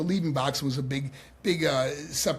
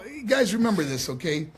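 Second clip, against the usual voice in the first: normal talking voice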